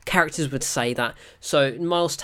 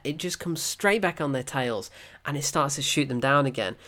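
ordinary talk, nothing marked

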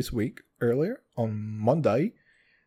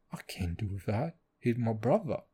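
low, soft Cockney imitation; half-hearted Cockney movie impression; Cockney accent; 4 semitones lower, 7 dB quieter than usual. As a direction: attempt a Cockney, quietly and lower. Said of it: "he fucking Cockney accent?".

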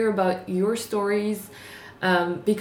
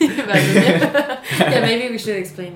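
laughing; 3 semitones higher, 8 dB louder than usual. Real, not acted. Laughing at the saying.

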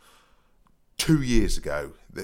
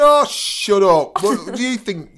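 loud, high mock outburst; exasperated retort; 12 semitones higher, 11 dB louder, slower than usual. Fake expert firing back at a radio caller, exasperated mock outburst. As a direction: drag out a loud, scornful comeback.

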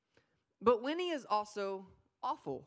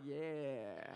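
unimpressed dog; 7 semitones lower, 9 dB quieter than usual. Retold or imitated. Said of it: "she's just like".

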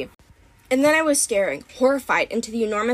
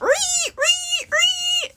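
very high helpless squeaks; shrill rodent chirps; tongue-tied guinea pig squeak; much slower than usual. A narrator's reading. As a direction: squeak instead of talking. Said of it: "couldn't have said anything except".

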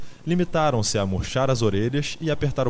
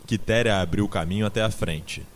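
ordinary delivery

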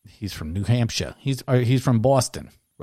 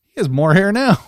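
much higher, louder disbelief; retold surprise; 11 semitones higher, 9 dB louder than usual. Podcaster retelling seeing an old photo, higher, louder surprise. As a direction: blurt it like you just caught a trick. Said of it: "I'm like".

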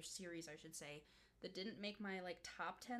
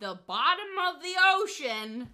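louder, higher, punch the climax; dramatic reveal; 8 semitones higher, 18 dB louder than usual. Real, not acted. Telling her friend where the cage fell, dramatically. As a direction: deliver it like a horror twist.

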